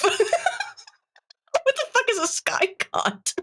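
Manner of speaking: high, slow disbelieving laugh. Real, not acted. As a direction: crack up in disbelief. Laughing while reading cringe post.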